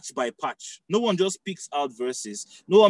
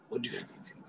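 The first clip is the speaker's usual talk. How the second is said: whispered mock question; 16 dB quieter, faster than usual. Mocking literal-minded believers.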